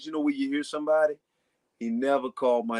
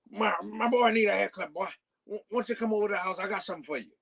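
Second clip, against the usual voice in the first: higher, fast coaxing invitation; imitating Cool Breeze; 6 semitones higher, faster than usual. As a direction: hurry him over with a grin, pitched up. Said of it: "That's how he talked".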